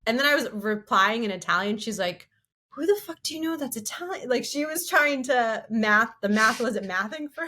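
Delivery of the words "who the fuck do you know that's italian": higher-pitched mock bewilderment; puzzled friend, pitched up; imitating baffled friend. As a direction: act out a friend's baffled question. Retelling baffled friend's reaction.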